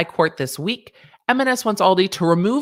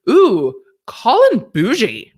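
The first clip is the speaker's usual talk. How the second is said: mock posh; 3 semitones higher than usual. Mocking Colin as posh.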